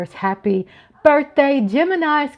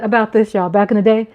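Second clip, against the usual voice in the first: usual speaking voice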